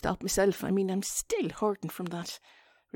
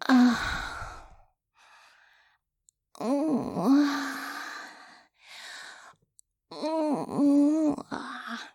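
drawn-out, higher, louder mock moans; put-on sexy moans; 6 semitones higher, 5 dB louder, much slower than usual. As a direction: moan theatrically, as if trying out sexy noises. Demonstrating sexy talk, awkwardly.

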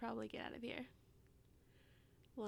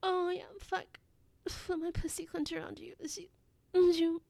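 moans growing louder and higher to a peak; building ecstasy; 4 semitones higher, 17 dB louder than usual. Acted. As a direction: let breathy pleading build into loud cries. Acted scene building to climax.

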